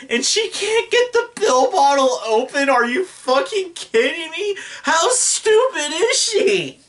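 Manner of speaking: high-pitched, disbelieving outburst; incredulous exasperation. Real, not acted. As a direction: voice climbing in amused outrage, last question drawn out. Incredulous rant at absurd film scene.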